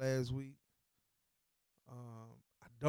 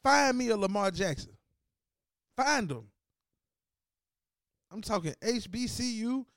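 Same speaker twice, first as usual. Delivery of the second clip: higher, louder daring demand; heated challenge; 9 semitones higher, 5 dB louder than usual. Real, not acted. Host daring the team to find another Lamar, heated. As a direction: dare them to find another one.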